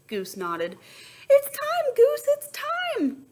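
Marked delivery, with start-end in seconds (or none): 1.3-3.2 high, loud frantic squawk; panicking duck announcing the hatch. A narrator's reading. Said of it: "Duck squawked".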